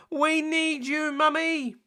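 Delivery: put-on high, slow little-girl plea; Peppa's voice. Peppa begging Mummy for help, high and slow. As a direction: plead like a small piglet.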